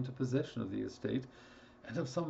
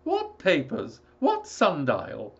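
high, loud father voice; an agitated father reading the letter; alarmed old father; 4 semitones higher, 8 dB louder, slower than usual. Acted.

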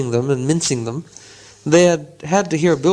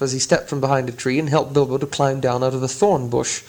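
usual speaking voice

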